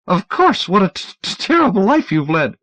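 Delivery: high, stammering frightened man; nervous stammer. Acted. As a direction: stutter it out high and anxious. Dunlop's stammering voice.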